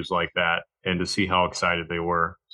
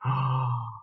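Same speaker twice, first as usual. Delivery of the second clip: gasping realization; 3 semitones higher, much slower than usual. Retold or imitated.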